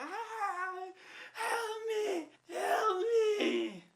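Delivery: very high, wailing mock screams; imitated screaming for help. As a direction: scream for help as if dying, hammed-up. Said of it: "I could hear her screaming outside".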